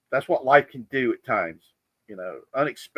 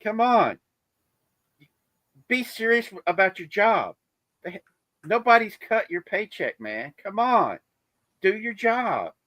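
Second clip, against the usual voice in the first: much higher, mock-stern scolding of the dog; hammed-up security guard telling the dog off; security guard scolding the drug dog, in a mock-stern high voice; 10 semitones higher than usual. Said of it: "security guys are looking at the dog going".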